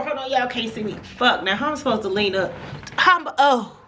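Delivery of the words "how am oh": higher pained oh; pained gasp. Real, not acted. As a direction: wince mid-movement. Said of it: "Oh, that was painful".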